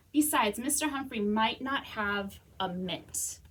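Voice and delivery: ordinary delivery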